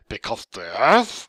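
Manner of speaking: slow, drawn-out mocking voice; annoying slow voice. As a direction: drag every word out obnoxiously. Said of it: "talking in this really annoying, slow voice".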